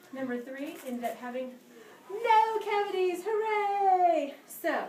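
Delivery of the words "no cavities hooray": loud high cheer. Acted. Cheering the happy ending for the class, loud cheer. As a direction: celebrate with the kids. Celebrating healthy tooth for kids.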